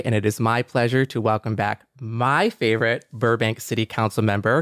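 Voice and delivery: ordinary talk, nothing marked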